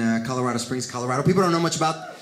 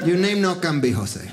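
a teasing Puerto Rican guy, lower; Puerto Rican accent; 4 semitones lower, 3 dB quieter than usual. Retold or imitated. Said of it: "He's like".

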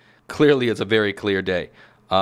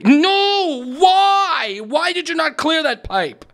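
very high, drawn-out wail of protest; loud, high-pitched exasperated outburst; despairing yell; 4 dB louder, slower than usual. Real, not acted. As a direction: cry out in gamer agony, then demand why. Crashes again mid story.

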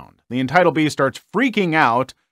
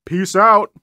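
louder, higher flippant goodbye; breezy brush-off; 5 semitones higher, 6 dB louder than usual. Retold or imitated. The sister dismissing her family with a flippant brush-off. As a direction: toss it off and walk away. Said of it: "in the end being like".

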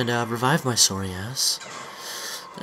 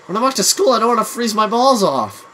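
high, raised-voice complaint; exasperated rant; 8 semitones higher, 5 dB louder than usual. Real, not acted. Arguing with friend about freezing outside, exasperated rant. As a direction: protest loudly, pitched way up.

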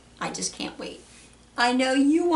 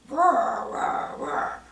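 playful imitated lion roars; much slower than usual. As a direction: roar like a lion for little kids. Voices the lion in picture book.

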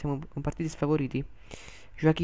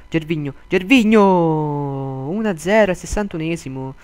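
goal commentary shout; 8 dB louder than usual. Real, not acted. Goal call in play-by-play.

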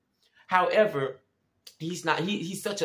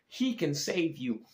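ordinary talk

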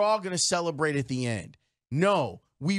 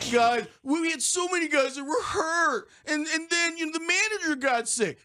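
high, tearful mock whine; Tony Gill sad voice; 10 semitones higher than usual. Host mimicking excuses about injuries, hammed-up sad voice. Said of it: "I'm gonna do the Tony Gill sad voice".

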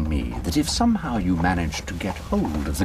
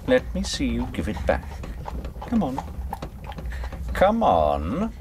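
goading taunt; 6 semitones higher than usual. Acted. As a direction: dare her to pull the trigger. Gunman goading Sophie to act.

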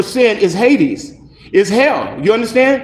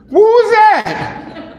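high, very slow laughing question; mock alarm at a voice inside; high, drawn-out "who was that" with a laugh; laughing startled; 11 semitones higher, much slower than usual. Startled by inner voice.

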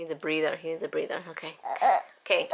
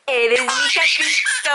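laughing through it; 6 semitones higher than usual. Real, not acted. Mother singing a jingle to her baby, laughing through it. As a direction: sing it while laughing helplessly.

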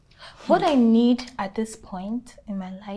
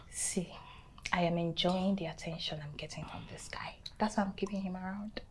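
confiding whisper; 12 dB quieter than usual. Acted. Friend confides she enjoys the attention, whispering. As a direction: whisper it like a guilty secret.

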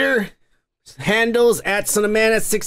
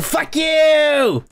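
higher, slow furious shout; 7 semitones higher than usual. Real, not acted. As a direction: spit it out, dragging each word in rage. Ranting at TikTok for muting.

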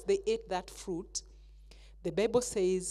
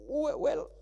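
nervous stammer; 5 semitones higher, 5 dB quieter than usual. Acted. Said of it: "how afraid he was".

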